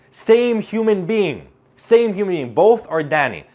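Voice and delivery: a confident speaker switching back to full power; high-energy delivery. Shown as a demonstration. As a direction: boom it out with full certainty. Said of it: "when I speak like this".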